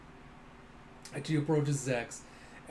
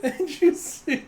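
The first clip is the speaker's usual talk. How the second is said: high, loud, slow words squeezed through laughter; real helpless laughter in the voice; talking through laughter; 11 semitones higher, 8 dB louder, slower than usual. Real, not acted. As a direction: try to carry on while cracking up. Trying to narrate while laughing.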